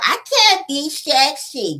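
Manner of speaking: slow, high, mock prissy whine; mocking Tisha. Vlogger mimicking Tisha's excuse again, mock whiny. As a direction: echo her line with a sneer. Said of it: "just stop".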